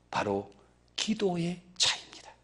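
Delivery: whispered punchline, voice dropped away; hushed aside to the congregation. Real, not acted. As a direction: lean in and breathe it. Preacher drops to a whisper.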